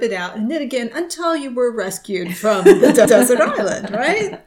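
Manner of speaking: laughing through the words; giggling while telling it. Real, not acted. Laughing at own joke.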